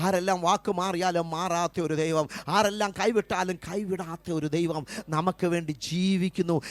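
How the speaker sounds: loud, driving sermon cadence; full-force preaching. Acted. Preacher calling out to weeping mothers, loud fervent preaching. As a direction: proclaim it to the whole hall.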